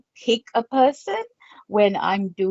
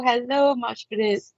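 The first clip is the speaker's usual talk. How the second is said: normal talking voice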